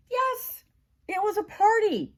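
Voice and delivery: plain reading voice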